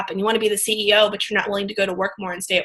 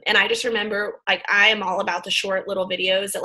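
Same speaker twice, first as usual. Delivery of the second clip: normal talking voice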